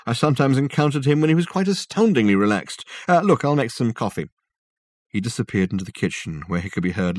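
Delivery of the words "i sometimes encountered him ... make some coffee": high, animated, tickled delivery; amused Reg figure. A narrator's reading. Reg remembering Coleridge very relaxed, amused. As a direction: recall it with great amusement.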